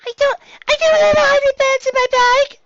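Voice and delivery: high, loud panicked blurt; filly yelping her denial at the teacher; panicked outburst. Acted. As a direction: burst out in a startled panic. Dinky blurts out her secret.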